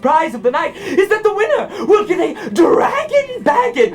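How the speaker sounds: high, manic voice full of laughter; crazed prize reader. Acted. [name] reading out prizes, laughing maniacally. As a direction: read it out as if losing your mind, cackling. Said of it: "as I go crazy over here".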